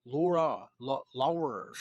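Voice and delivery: imitating another man's Laura and lure; imitated pronunciation. Host imitating the fishing guy's pronunciation of Laura and lure. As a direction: try out his odd vowel in Laura and lure. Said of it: "He, he says, like".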